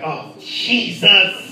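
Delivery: slow, laughing mock fright. Frightened after table bang.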